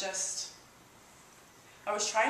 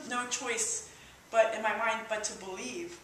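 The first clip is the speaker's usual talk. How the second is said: ordinary talk, nothing marked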